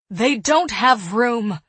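loud, slow, emphatic refusal; fierce. A narrator's reading. Woman refusing to move the boys, fierce. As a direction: say it fiercely, weighting each word. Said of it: "Eliza said fiercely".

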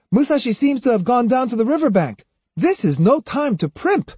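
put-on high-pitched, hurried boy's voice; impatient boy. A narrator's reading. Boy rushing Otsu to follow Musashi, impatient and high. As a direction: urge her along in a high, impatient voice. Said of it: "Jotaro called impatiently".

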